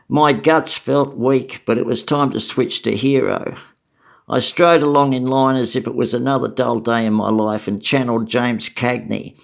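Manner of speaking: ordinary delivery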